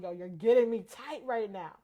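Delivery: loud, exasperated warning; frustrated. Retold or imitated. Mother losing patience with her sleepy son, loud and exasperated. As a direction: let the irritation burst out loud.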